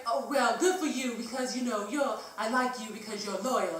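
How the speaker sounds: hammed-up tipsy British flattery; bad British accent. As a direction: imitate a drunk Brit being complimentary. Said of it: "my really bad British accent".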